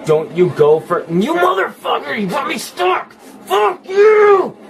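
high, raging yells; furious screaming. Real, not acted. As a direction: scream in pure frustration. Stuck and raging at game.

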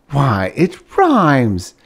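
slow, marvelling delight; wondering. A narrator's reading. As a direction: savour it with wonder. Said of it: "a wondering smile".

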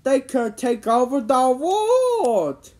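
high, slow, loud warning; dramatic warning. A narrator's reading. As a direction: build to a drawn-out cry of doom. Dire warning about the pants.

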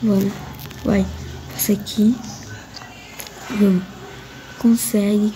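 normal talking voice

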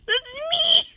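high, loud excited squeal. Real, not acted. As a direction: squeal it, delighted. Pointing at own entry, repeated.

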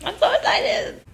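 ordinary delivery

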